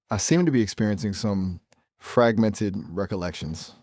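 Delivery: ordinary delivery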